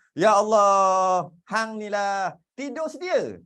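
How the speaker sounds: put-on old Kedah mother scolding a sleepy child; mock scolding, loud and stretched; exasperated Kedah parent. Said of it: "orang-orang tua Kedah dulu selalu cakap".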